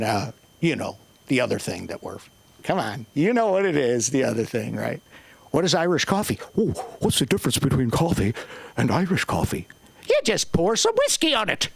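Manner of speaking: normal talking voice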